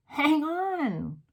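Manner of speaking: a high urgent call. A narrator's reading. Mildred asking the room to wait, high call. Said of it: "cried the witch called Mildred".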